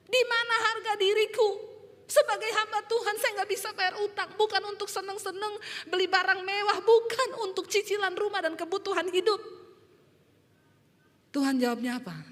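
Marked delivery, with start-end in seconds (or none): 0.1-9.4 high-pitched, anguished wail to God; tearful protest. Retold or imitated. Shamed pastor protesting to God, crying out in high voice. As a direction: re-enact a crying prayer building louder. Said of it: "saya nangis".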